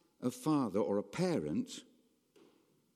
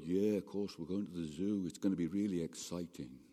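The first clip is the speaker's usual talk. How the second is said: low, easy parent's reply; reassuring parent; 6 semitones lower, 3 dB quieter than usual. Acted. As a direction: answer an excited child in a low, settled voice. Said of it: "You're going".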